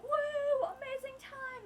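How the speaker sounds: high-pitched hammed-up whoop; exaggerated high excitement; mock party cheer. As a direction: cheer like the fun crowd. Imitating others having a great time.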